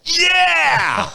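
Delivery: high, loud triumphant whoop; cheering yell. Retold or imitated. Husband cheering at the TV to his wife, loud whoop. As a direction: shout it like your team just scored. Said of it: "I turned to my wife and I went".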